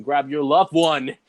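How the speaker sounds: usual speaking voice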